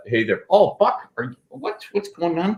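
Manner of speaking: a hint of a Texas Southern accent; lightly voiced Southern dog. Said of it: "a, a Southern accent".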